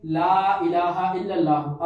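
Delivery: ला इलाहा इल्लल्लाह with ला drawn out long and loud. Shown as a demonstration. Teacher reciting to students, ला stretched long. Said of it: "अब ला जो है ना इसको लंबा करेंगे".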